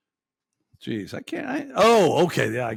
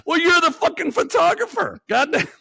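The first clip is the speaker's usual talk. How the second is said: exasperated rant; 12 semitones higher, 4 dB louder than usual. Real, not acted. Ranting at the photographer.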